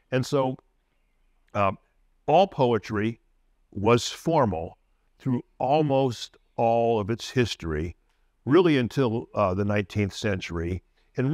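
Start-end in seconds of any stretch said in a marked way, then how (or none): none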